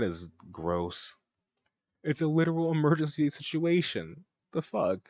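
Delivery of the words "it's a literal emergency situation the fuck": higher-pitched real outrage; indignant. Real, not acted. Commentator reacting to a medical emergency penalty, indignant. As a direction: protest in disbelief.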